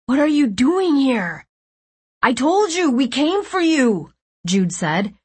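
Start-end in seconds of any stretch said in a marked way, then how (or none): 0.1-1.5 high, urgent questioning; alarmed dismay. A narrator's reading. Nico to the unexpected rescuers, alarmed. 2.2-4.1 high-pitched, eager insistence; excited insistence. A narrator's reading. Jude telling Nico they came for him, insistent.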